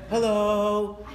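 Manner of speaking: drawn-out, monotone dejected greeting; mock depressed voice. Preacher mimicking depressed people on the phone, droning dejected voice. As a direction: imitate a listless caller, one long droning hello. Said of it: "soft voice. You know, people call me".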